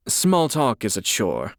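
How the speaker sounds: ordinary talk, nothing marked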